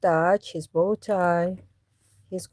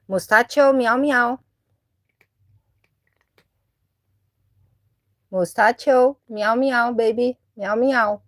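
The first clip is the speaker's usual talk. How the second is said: high, louder sing-song cat call; 6 semitones higher, 7 dB louder than usual. Real, not acted. Owner calling her cat away from his food, high coaxing meows. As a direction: coax him over with meows.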